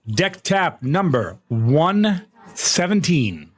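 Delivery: slow, stretched-out countdown voice; drawn-out announcement. Acted. Streamer announcing the tap to chat, slow and drawn out. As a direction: drag it out like a big reveal.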